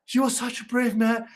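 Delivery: lightly gushing stage heroine; mock swooning woman. Mocking a school play, a lightly swooning woman. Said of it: "then the woman goes".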